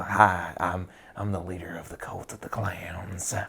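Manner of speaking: raspy Southern whisper of a cult leader; a sinister Southern preacher whispering; Southern cult leader accent. Shown as a demonstration. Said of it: "get a nice Southern accent like this".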